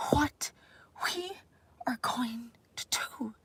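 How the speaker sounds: a light mock of a halting politician, partly whispered; hint of a stiff speechmaker searching for words; Justin Trudeau impression. Lightly imitating a politician's halting tariff speech. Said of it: "that was a times ten version of Justin Trudeau".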